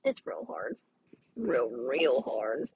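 hammed-up deep lament; mock deep groan. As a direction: sink the voice way down in comic despair. Joking about how hard class is.

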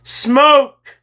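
high, loud shout of alarm; sudden cry. A narrator's reading. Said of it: "Kyle suddenly cried".

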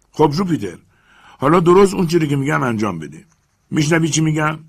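commanding master. Acted. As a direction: lay down the instructions firmly. Legrand commands.

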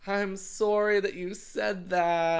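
high, loud, slow put-on apology; imitated mock apology. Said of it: "And they'll be like".

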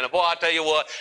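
ordinary talk

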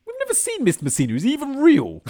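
high, questioning office worker; incredulous worker. Retold or imitated. Worker asking colleague if the boss exists, high and doubtful. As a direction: raise it high in disbelief.

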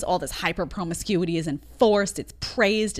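usual speaking voice

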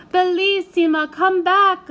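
high, loud Italian plea; calling out. A narrator's reading. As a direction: shout after her begging. Uncle calling after girl.

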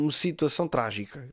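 usual speaking voice

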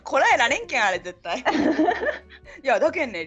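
laughing while talking; giggling through the words. Real, not acted. Laughing about the memory.

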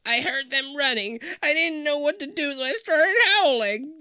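high, loud panicky geek voice; terrified, shaky voice. A narrator's reading. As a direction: blurt it out in fear. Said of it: "in a terrified and shaky voice".

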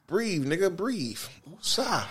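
hushed, soothing breathe cue; soft calming. Real, not acted. Calming down his heated cohost, hushed and soothing. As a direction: talk him down softly. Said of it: "calming his cohost".

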